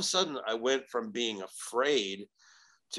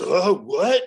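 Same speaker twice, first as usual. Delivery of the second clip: higher, louder startled question; confused; 6 semitones higher, 7 dB louder than usual. Retold or imitated. Possessed man suddenly challenged, acting out confusion. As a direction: blurt it as if caught off guard. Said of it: "And you see the confused".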